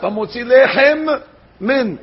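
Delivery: "Lechem" ending in a held m, then "Min"; slow, clear, two separate Mems; both Mems sounded. Shown as a demonstration. Rabbi demonstrating the correct blessing. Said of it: "Don't swallow the Mem".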